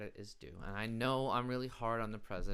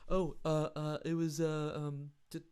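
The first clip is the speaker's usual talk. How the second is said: higher, put-on voice stalling for a name; mock Trump fumbling; 5 semitones higher than usual. Comedian imitating the president making up a doctor, hesitant. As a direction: fake searching for an invented name. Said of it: "I don't think there was a doctor".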